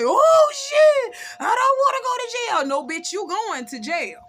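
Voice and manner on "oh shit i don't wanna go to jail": high, loud, fast mock panic; panicked. Khadijah realizing she shot him, high frantic panic. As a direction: squeal it out in a frantic rush. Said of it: "you hear Khadijah say".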